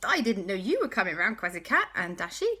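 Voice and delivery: put-on children surprised by visitors, eager; excited. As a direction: gush at the visitors. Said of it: "And they went".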